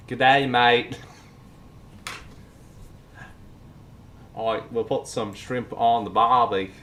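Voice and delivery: loud mock Australian accent. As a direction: put on a hammy Aussie voice. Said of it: "Oh, Australian".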